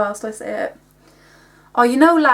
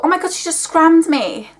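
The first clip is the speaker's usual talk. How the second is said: high-pitched mock outrage; acted outraged exclamation; 7 semitones higher, 3 dB louder than usual. Someone reacting to being scratched, acted outrage.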